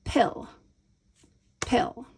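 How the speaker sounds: "pill" said clearly on its own; isolated syllable. Shown as a demonstration. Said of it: "let's take our first syllable".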